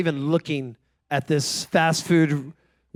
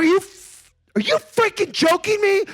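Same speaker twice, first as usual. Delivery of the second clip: high-pitched, indignant rant; incredulous outrage; 13 semitones higher, 4 dB louder than usual. Retold or imitated. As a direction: act out exasperated disbelief at a niece. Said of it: "Why are you getting so mad".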